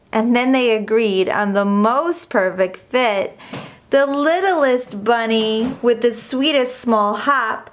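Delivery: ordinary delivery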